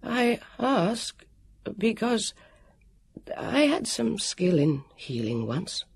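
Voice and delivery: high, hesitant man's voice; nervous, fluting voice. A narrator's reading. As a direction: falter gently in a high, fluting tone. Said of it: "in his nervous, fluting voice".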